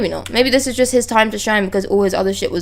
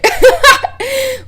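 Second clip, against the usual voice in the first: high burst of laughter; real laughing fit; 5 dB louder than usual. Real, not acted. Laughing at the scene.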